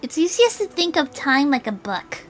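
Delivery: put-on high girlish character voice; high, earnest young storyteller; explaining girl. Voices Julie explaining her theory.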